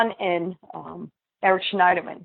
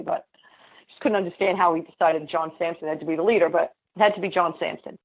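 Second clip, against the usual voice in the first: ordinary talk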